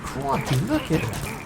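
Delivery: put-on high, excited Australian accent; a playful Australian "crikey"; mock Australian accent. Streamer joking about a game creature, in a mock Australian accent. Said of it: "[name]'s Australian accent".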